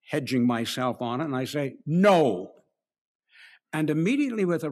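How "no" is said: "No." louder, curt and final; emphatic no. Shown as a demonstration. Preacher shows how he answers Al, emphatic curt no. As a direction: shut the question down with "No.". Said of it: "not only said no, but the intonation".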